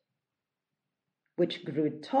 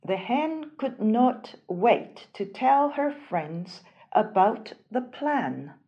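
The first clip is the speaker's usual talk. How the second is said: plain reading voice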